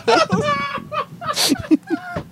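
squealing burst of laughter; high shrieking laugh. Real, not acted. Laughing at bean reaction.

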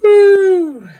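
loud high whoop to kick off the show; excited cheer. Real, not acted. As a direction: hype the crowd with a big whoop. Show intro hype cheer.

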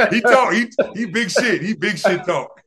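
high, loud, laughing broken-English jab; laughing mimicry. To his guest, ribbing an old rival while laughing. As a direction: chuckle through a teasing imitation.

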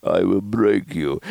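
put-on slow, menacing Russian accent; Russian accent, mock menace; Russian billionaire accent. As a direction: threaten like a cartoon Russian tycoon. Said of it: "And I'm like".

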